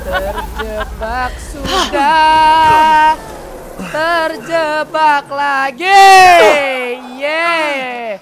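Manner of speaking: high, drawn-out loud wail; loud trapped wail. Real, not acted. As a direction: wail every word out long, loud and high. Player trapped in the game. (teriakan meratap panjang karena terjebak)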